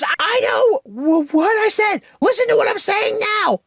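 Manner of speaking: mother snapping at Violet to listen, shouting high; imitating Helen's shout. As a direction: stammer then yell it like a frantic mom. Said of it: "I can't do female voices".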